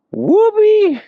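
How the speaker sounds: a loud, very high whoop of triumph; excited whoop. Real, not acted. Celebrating finishing the roll.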